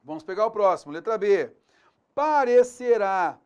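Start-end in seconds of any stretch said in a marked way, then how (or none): 2.1-3.4 "Parecerá" slow, higher and louder; stressed verb. Shown as a demonstration. Teacher reading option B, spotlighting "Parecerá". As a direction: draw out "Parecerá" to mark the verb. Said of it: "Aqui eu tenho o modo indicativo".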